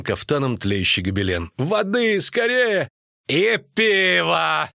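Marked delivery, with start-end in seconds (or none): 1.6-2.9 high-pitched, urgent call; urgent cry. A narrator's reading. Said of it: "кричал один из трепских братьев". 3.3-4.7 high, drawn-out hoarse call; hoarse cry. A narrator's reading. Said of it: "прохрипел Куткудак".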